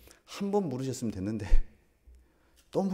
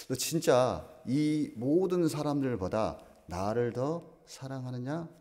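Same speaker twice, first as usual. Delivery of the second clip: drawn out slowly, each word weighed; a slow, searching question to Peter; slow pressing question; 2 semitones higher, much slower than usual. Acted. Jesus pressing Peter again.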